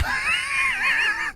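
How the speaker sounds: high shrieking burst of laughter. Real, not acted. Streamer losing it at a chat joke, shrieking laugh. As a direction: let the laugh crack up high.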